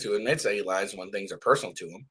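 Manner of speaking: talking with a blocked, stuffy nose; congested stuffy voice. Real, not acted. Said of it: "I don't know why I'm so stuffy".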